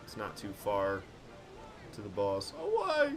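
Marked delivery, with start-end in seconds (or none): 2.6-3.1 high hammed-up shout of away; mock enemy yell. Streamer copying an enemy's battle cry, hammed-up shout. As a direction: bellow it like a game enemy. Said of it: "I love it when they yell that".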